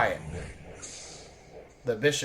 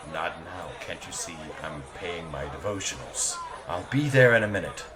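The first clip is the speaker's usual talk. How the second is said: low commander voice; 10 semitones lower, 4 dB quieter than usual. Acted.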